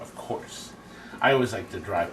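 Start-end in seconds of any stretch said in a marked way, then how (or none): 0.0-0.7 whispered aside. Real, not acted. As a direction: breathe it out unvoiced.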